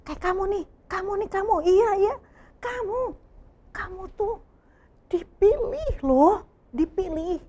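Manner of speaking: a touch of playful excitement; playful emphasis. Real, not acted. Pointing out children warmly.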